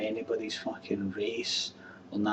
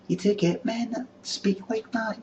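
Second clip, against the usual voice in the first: lightly raised, effeminate lilt; a touch of a camp man; a hint of effeminate voice; 12 semitones higher than usual. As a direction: slip into a higher camp voice. Implying effeminate voice.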